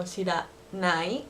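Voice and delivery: "shiranai" drawn out slowly with a rising end; slow standard form. Shown as a demonstration. Teacher contrasts standard "shiranai" with the Osaka form. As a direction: stretch out "shiranai" syllable by syllable. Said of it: "So instead of".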